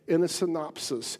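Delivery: normal talking voice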